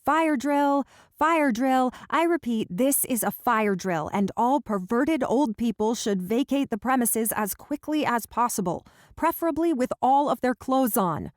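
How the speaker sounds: loud, high announcement shout; loudest voice. Acted. Laura calling out to the parents from the closet, loud announcement. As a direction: bellow it like a fire warden. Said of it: "call out in my loudest voice".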